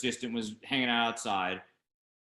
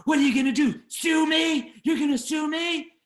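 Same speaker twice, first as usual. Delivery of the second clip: very high, loud, taunting yell; re-enacted screaming challenge, far above usual pitch; imitated screaming boss; 6 dB louder than usual. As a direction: act out a raging boss daring you. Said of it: "he's screaming and screaming at me".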